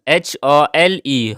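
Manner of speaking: slow letter-by-letter spelling; spelling aloud for learners; spelled out. Shown as a demonstration. Spelling out the word.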